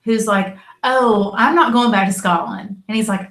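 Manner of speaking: usual speaking voice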